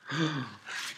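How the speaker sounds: soft gasp of amazement; storyteller's gasp. A narrator's reading. Storyteller sees monkeys wearing all the caps, soft amazed gasp. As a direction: draw in a quick, quiet gasp.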